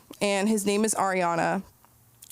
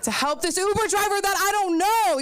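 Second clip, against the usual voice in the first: mock high; 10 semitones higher, 3 dB louder than usual. Retold thought, rising.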